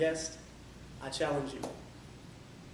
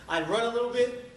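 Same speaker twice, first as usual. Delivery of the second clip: higher, louder, pushing upward; energetic running take; 6 semitones higher, 5 dB louder than usual. Retold or imitated. Runner recounts the last ten miles, voice raised and pushing. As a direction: lift the pitch and push, as if running. Acts out running versus walking.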